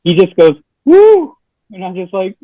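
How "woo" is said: high, loud whoop; mock cheer. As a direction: whoop it up like the lone fan left. Friend cheering in empty room.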